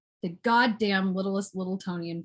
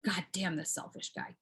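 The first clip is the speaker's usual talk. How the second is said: low, soft curse to yourself; muttered grumble; 4 semitones lower, 12 dB quieter than usual. Pretend. Griping about the selfish guy, muttered grumble. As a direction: drop it under your breath. Grumbling aside after refusal.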